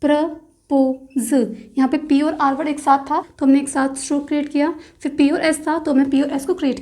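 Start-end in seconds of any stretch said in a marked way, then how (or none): 0.0-1.3 propose stretched very slowly while tracing; drawn-out word. Shown as a demonstration. Teacher tracing the proposed stroke, very slow. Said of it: "तो stroke बनेगा".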